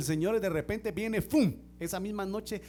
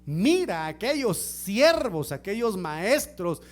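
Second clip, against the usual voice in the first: ordinary talk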